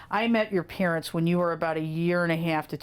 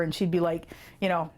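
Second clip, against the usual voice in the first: normal talking voice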